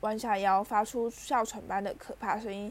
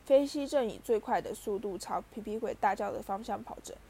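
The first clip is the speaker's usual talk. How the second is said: plain reading voice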